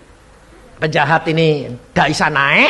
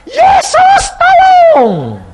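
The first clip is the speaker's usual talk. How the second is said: very high, full-voiced desperate scream; terrified maid shrieking for rescue; screamed cry for help; 9 dB louder, much slower than usual. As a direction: scream it out, stretching the words. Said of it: "mau teriak-teriak".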